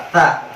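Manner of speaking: "Ta" with a crisp t and no s hiss; clean ta. Shown as a demonstration. Teacher modelling the letter ta, clean and crisp. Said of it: "Coba sebut huruf ta".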